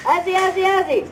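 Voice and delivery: high-pitched rallying chant; Aussie cheer. Shown as a demonstration. Animal presenter leads the fair audience in a thank-you cheer, high rallying chant. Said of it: "great big Aussie cheer".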